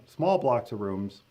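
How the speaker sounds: ordinary talk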